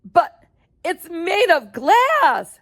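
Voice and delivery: high, halting alarm, slow; panicked stammer. Acted. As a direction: sputter in disbelief. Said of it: "shouted Grandpa Joe".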